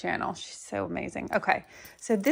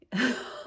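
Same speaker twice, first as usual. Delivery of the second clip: giggle; 3 semitones higher, 4 dB quieter than usual. Real, not acted. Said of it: "I'm giggling".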